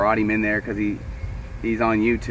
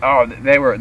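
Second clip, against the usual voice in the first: ordinary talk, nothing marked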